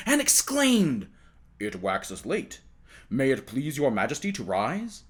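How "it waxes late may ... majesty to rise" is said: the First Lord waking the king, low and deferential. Acted. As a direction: gently rouse his majesty. Courtier wakes the king.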